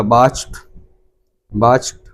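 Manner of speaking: बाच्ड said "watcht", ending in t; correct pronunciation. Shown as a demonstration. Teacher showing class बाच्ड ending in t. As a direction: end बाच्ड on a clear t. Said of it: "टा का pronunciation आपको करना है".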